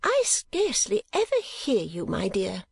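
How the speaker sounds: high-pitched huffy retort. A narrator's reading. Mother retorting to her daughter's defence, high and huffy. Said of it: "retorted Mrs. Nickleby".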